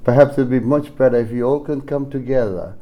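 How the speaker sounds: ordinary talk, nothing marked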